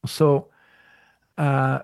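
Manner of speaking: ordinary delivery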